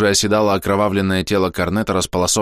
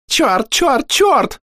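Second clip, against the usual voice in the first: shrill panicked screech, much higher, louder; hysterical shriek; 4 dB louder, much slower than usual. A narrator's reading. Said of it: "заверещал истеричный голос". (истеричный визг)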